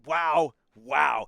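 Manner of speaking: loud, amazed wows; too loud. Real, not acted. Commentator stunned by the big finish, loud amazement. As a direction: belt out real amazement. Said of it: "I'm getting too loud on the microphone".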